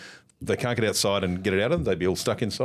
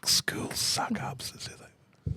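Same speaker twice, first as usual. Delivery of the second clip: teasing whisper; 6 semitones lower, 7 dB quieter than usual. Real, not acted. Friend needling Connor for being a suck-up, sly hushed tease. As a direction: murmur it like a secret accusation.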